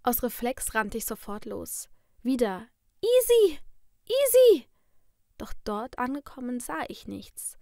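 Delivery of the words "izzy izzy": very high, loud cry; shrieked name, pitched far up; calling from afar. A narrator's reading. Sister's taped voice from the kitchen, calling out high. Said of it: "rief es aus der Küche".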